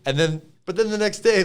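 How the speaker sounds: ordinary talk, nothing marked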